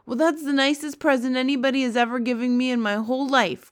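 delighted cry. A narrator's reading. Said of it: "cried the B F G".

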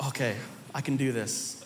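very low, soft self pep talk; hushed, deep resolve; quiet self-encouragement. Retold or imitated. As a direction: murmur it low to yourself. Self pep talk after regaining confidence.

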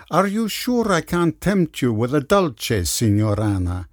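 put-on lilting Italian accent. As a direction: sing it out like a charming Italian waiter. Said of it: "he lilted, with the Italian accent".

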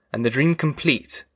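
ordinary delivery, nothing marked

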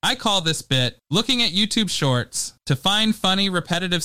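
ordinary talk

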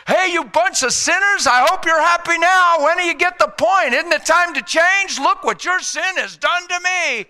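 high, loud accusing harangue; mock Jesus yelling blame from the cross; scolding mock preaching. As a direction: berate them like a guilt-tripping preacher. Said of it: "That's how people preach".

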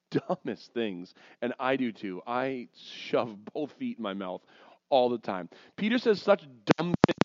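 ordinary talk

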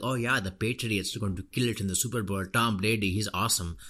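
put-on American accent of an Indian expat; hammed-up NRI fan showing off a new American accent; NRI Patriots-fan accent. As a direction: do a mock NRI sports fan's acquired accent. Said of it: "that's my N R I Patriot fan accent".

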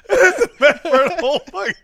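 real laughter breaking through the words; helpless laughter. Real, not acted. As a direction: talk while unable to stop laughing. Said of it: "I can't stop laughing".

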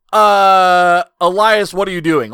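loud drawn-out "uh" then baffled question; mock-baffled crowd voice; exasperated disbelief. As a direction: call him out, loudly incredulous. Voicing everybody's disbelief at the wrestler.

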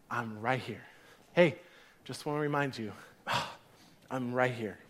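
very soft, low, intimate voice; gentle reassurance. Acted. God coming alongside people, gently reassuring. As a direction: reassure quietly, with pauses and a sigh.

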